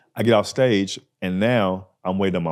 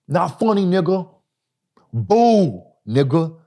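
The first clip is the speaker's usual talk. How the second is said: high, loud mocking jeer; heckler jeer; 11 semitones higher, 6 dB louder, slower than usual. Heckler jeering loudly at the struggling comic. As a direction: taunt the comic from the crowd. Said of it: "That's what he said".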